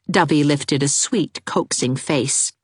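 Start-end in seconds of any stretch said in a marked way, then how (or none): none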